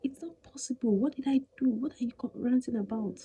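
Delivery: mock the husband's innocent denial. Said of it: "He said".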